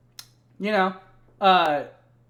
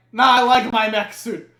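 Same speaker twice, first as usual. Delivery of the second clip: louder, defiant nah; stubborn refusal; 5 semitones higher, 9 dB louder than usual. Retold or imitated. Drunk Nikolai refusing to leave his mech, stubborn. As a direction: refuse like a sulky kid. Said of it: "And he's like".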